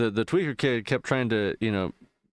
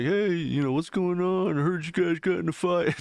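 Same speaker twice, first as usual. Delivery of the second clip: higher-pitched take on an unaware dad; imitated concerned dad; 8 semitones higher than usual. Dad getting closer to the zombie, imitated concern. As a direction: lightly act out a dad checking in.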